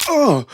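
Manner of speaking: sharp high groan of effort as stiff knees crack; loud strained ugh as the body protests; pained groan. Acted. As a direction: push up through the pain with a loud grunt.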